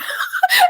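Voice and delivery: sudden high laugh at her own revelation; burst of laughter. Real, not acted. Telling a friend about a revelation, bursting into high laughter.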